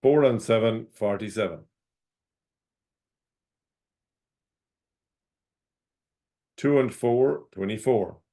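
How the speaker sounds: calling each number out, then repeating it; sing-song bingo number calls; bingo caller. Acted.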